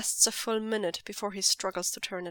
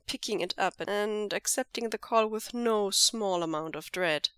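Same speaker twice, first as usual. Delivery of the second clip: ordinary delivery, nothing marked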